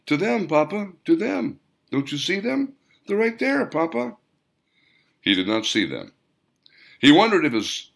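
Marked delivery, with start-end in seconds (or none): 0.1-4.2 put-on much higher little boy voice, eager and insistent; a five-year-old begging his papa to see; young child. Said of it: "his five-year-old pointed and said".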